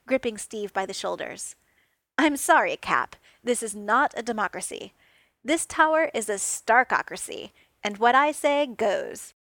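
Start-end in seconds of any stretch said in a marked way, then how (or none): 2.2-9.3 mock stern. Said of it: "He did his best to look stern".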